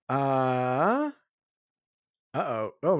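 ordinary delivery

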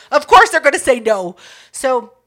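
usual speaking voice

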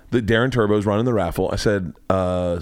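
ordinary delivery

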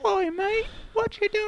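very high, squeaky British lad; British impression. Co-host mimicking a film's British actor, high mock British voice. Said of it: "Jeremy Bell's all British".

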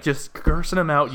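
ordinary talk, nothing marked

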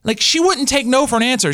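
ordinary talk, nothing marked

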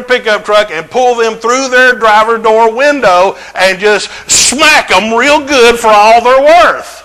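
a preacher working up a mock road rage; building excitement.

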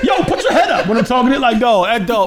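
high, laughing mock order; joking intimidation. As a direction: boss them around as a joke. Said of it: "[name], [name] was joking".

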